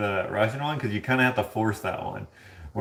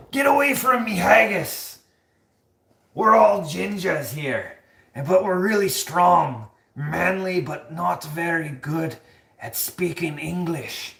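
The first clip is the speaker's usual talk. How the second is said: high, slow comic Scottish; hammed-up Scottish brogue; Scottish accent; 7 semitones higher, 4 dB louder, slower than usual. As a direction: play a blustering Scotsman, pitched up high. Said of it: "What is something that a Scotsman would say?".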